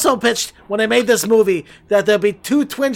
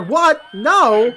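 mock surprise; 3 semitones higher than usual. Mark reacts to another shark.

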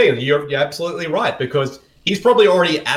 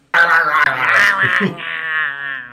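long wordless garbled yell; Gary Busey yell. Acted. Playing an actor with no lines, wordless yelling. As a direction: yell out a drawn-out wordless noise. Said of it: "it's Gary Busey".

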